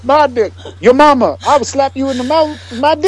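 a lightly done comic threat; mock rant.

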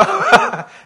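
real laugh, high and loud; high burst of laughter. Real, not acted. Preacher laughs at his own point, loud and high.

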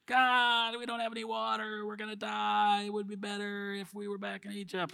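high, soft whining complaint; whiny Israelites. Retold or imitated. Israelites whining to God for water, high soft whine. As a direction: whimper it like a spoiled crowd. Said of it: "Israelites complaining again".

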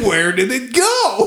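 high, drawn-out mock cry; mock dramatic question. Host playing up the tuck joke, high drawn-out question. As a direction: stretch it out slowly, pitched well up.

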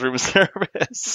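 drawn-out, high mock yell with laughter. Player insisting Otto really does it, laughing mock yell. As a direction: stretch it out high, laughing through a pretend yell. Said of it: "Otto actually yells".

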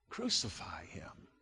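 very soft, low narrated shout; hushed crowd cry. A narrator's reading. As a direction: echo the crowd's cry far under the voice. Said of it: "they shouted".

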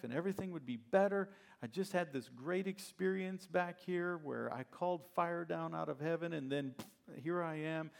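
frustrated Elijah. Retold or imitated. Preacher voicing Elijah frustrated on the run, whiny. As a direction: vent at God, fed up and confused. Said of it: "He was frustrated".

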